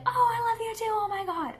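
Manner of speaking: very high, squealing doggy reply; excited dog voice. Pretend. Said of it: "jump up and down and be like".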